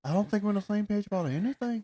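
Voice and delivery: imitating an Irish reality star; mock Irish accent. A friend hams up an Irish accent quoting Dempsey. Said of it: "that's that your accent?".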